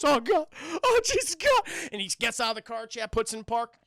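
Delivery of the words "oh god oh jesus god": high, loud, rising despair; panicked. Acted. Charlie as his car just stops, panicking. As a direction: cry out in acted panic.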